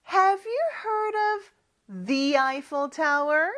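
very slow rising question; slow model sentence. Shown as a demonstration. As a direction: model it word by word. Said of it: "Eiffel Tower 넣어서". (아주 천천히 끝을 올리는 시범 문장)

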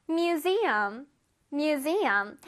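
museum, drawn out; slow word drill. Shown as a demonstration. Teacher modeling museum for children. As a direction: say museum slowly for the class. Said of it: "One more time".

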